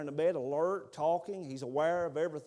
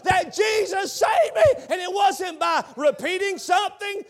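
loud, high sermon climax; preaching at full force; 14 dB louder than usual. Acted. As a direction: preach it out to the rafters.

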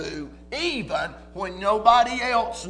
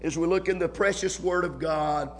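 prayer; 3 semitones lower than usual. Real, not acted. Prayer before the sermon.

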